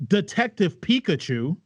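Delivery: ordinary talk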